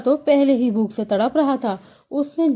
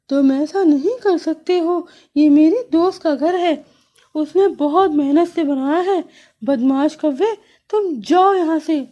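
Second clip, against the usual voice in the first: higher, louder bird telling the crow off; indignant bird voice, raised; scolding bulbul; 5 semitones higher, 3 dB louder than usual. Acted. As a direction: stand up for her friend.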